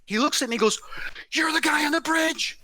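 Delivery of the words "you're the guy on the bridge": high, amazed retold exclamation; stunned recognition. Man recognises the speaker from his dream, astonished.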